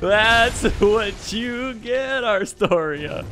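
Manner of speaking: slow, high gloating taunt; drawn-out villainous gloat. Acted. Taunting opponent in duel.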